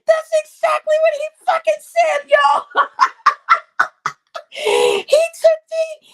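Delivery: high, loud, laughing through the words; can barely speak for laughing; laughing hard. Real, not acted. Losing it laughing mid story.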